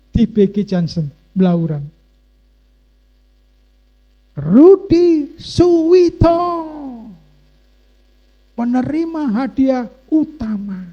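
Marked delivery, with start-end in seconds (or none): 4.3-10.8 loud, high, drawn-out name call; prize-draw announcer. Retold or imitated. MC calls the grand-prize winner to the crowd, imitated announcer. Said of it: "Tiba-tiba nama saya disebut".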